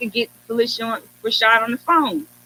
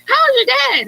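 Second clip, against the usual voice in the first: much higher and louder, bubbly questions; excited retelling; 6 dB louder, slower than usual. Retold or imitated. Guest voicing the star's warm greeting in an excited, high voice. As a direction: gush the questions, still on a high.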